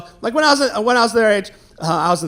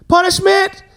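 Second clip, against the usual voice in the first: put-on high kid voice; 9 semitones higher than usual. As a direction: play the hyperactive kid blurting it out. Kid's answer voiced high, loud.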